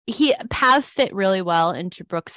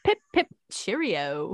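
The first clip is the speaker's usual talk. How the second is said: slow, lilting British send-off; mock British accent. Second host copying the bit, mock British accent. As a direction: draw it out in a put-on British accent. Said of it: "except for my British accent".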